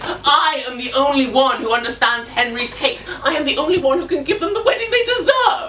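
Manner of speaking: rising, quickening self-important declaration; impassioned diva proclamation; grandiose outburst. Acted. Baker's grandiose outburst, builds.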